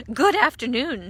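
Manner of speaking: called greeting; calling out. A narrator's reading. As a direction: call across the garden. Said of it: "she called".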